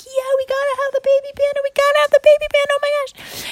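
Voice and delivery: very high, fast excited squealing; kids freaking out. Acted. Kids begging parents to keep panda, freaking out. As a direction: gush like over-excited children. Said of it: "Candy and Bar are freaking out".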